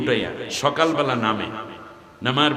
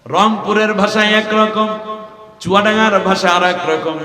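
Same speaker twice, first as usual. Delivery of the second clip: loud, high, slow preacher's call; preaching at full force; 7 semitones higher, 7 dB louder than usual. Acted. Preacher calling out the districts to the crowd. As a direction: ring out each place name.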